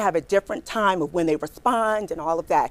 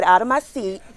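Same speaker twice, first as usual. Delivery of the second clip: normal talking voice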